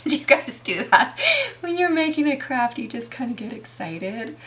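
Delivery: giddy excitement. Real, not acted. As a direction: let real excitement bubble up through laughs. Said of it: "I'm getting excited".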